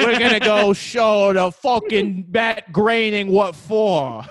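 slow, loud, high wiseguy threat; drawn-out Italian-American mobster bluster; mock Italian-American. Continues the Italian stereotype bit.

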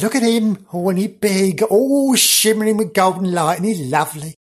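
high, gushing country wife; awestruck farmer's wife. Acted. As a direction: coo over him admiringly. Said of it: "she goes".

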